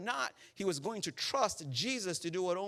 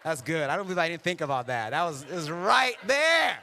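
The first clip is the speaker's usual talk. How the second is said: talking through a real laugh, building loud; delighted laughter; 6 dB louder than usual. Real, not acted. Preacher amazed at his own unplanned joke, laughing. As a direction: laugh it out, stretching "right there" loudly.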